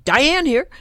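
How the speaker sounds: loud, high-pitched phone greeting; matched abrupt greeting. Shown as a demonstration. Coach mirroring her ex-Marine client on the phone, punchy. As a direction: mirror a military man's punchy phone answer. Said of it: "I'd say".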